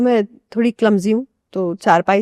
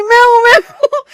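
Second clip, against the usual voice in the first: high, loud, laughing wail of an embarrassed aunt; flustered aunt; 13 semitones higher, 8 dB louder than usual. Retold or imitated. Aunt who rolled over owns up, high and loud through laughter. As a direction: squeak it out through giggles, mortified. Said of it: "ये वाली aunty बोलती".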